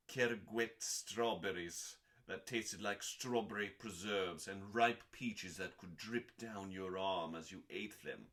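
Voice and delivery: usual speaking voice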